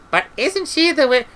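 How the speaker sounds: high, querying character voice; anxious objection. A narrator's reading. Treatle questioning the witch's presence, high objection. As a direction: object in a high voice until cut off.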